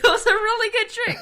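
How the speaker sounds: high, loud squeal of laughter; laughing hard. Real, not acted. Agreeing with co-host's one-trick pony joke, shrieking with laughter. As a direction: barely get it out for laughing.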